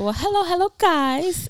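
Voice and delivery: big podcast hello; sing-song greeting. Real, not acted. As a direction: greet the listeners in a lilting high voice. Show greeting to listeners.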